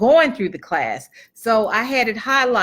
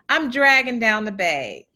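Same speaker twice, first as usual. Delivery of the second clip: ordinary talk, nothing marked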